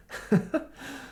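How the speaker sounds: short high laugh; brief high-pitched chuckle; disbelieving laugh. Real, not acted. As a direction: laugh at the absurdity. Laughs at the absurd demand.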